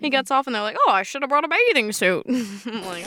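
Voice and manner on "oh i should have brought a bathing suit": put-on Mid-Atlantic film accent; 1950s movie star voice; old-timey voice. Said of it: "I love your old-timey voice".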